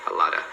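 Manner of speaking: A LOTTA, low, ending in uh; reduced form. Shown as a demonstration. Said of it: "como se fosse um a".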